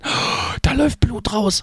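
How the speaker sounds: gasped, then very high-pitched alarm; imitated horrified sister-in-law. Sister-in-law sees his bleeding eye, imitated horror. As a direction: mimic a squeal of horror at the sight. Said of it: "meine liebe Schwägerin sagte dann".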